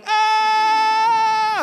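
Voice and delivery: very high, loud long scream; falling scream. Shown as a demonstration. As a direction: demonstrate a long drop from sixty floors. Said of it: "ganito yung tunog".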